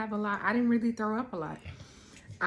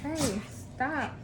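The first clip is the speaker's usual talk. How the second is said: scolding; 6 semitones higher than usual. Real, not acted. Scolding kids off camera.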